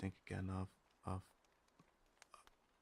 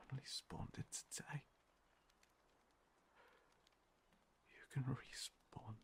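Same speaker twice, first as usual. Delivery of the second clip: whisper; 2 semitones higher, 7 dB quieter than usual. Acted. Confiding secret to newcomer.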